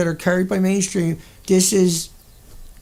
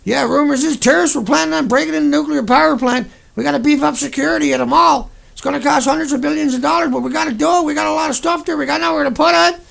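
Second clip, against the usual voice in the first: mock radio spokesman; 6 semitones higher than usual. Mocking a nuclear spokesman on the radio, higher glib voice. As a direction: ham up the PR man on the radio. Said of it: "you see 'em on another radio station".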